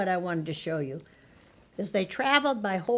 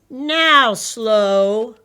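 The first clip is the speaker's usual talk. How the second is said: proud father; 3 semitones higher, 12 dB louder than usual. Acted. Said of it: "said Slow's father".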